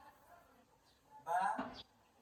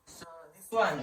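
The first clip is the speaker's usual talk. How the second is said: ordinary talk, nothing marked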